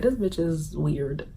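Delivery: quiet drawn-out aside; imagined viewer reaction. Pretend. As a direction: mutter it as an unimpressed onlooker. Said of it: "Y'all probably looked at the screen like".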